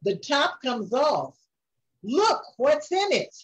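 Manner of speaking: ordinary delivery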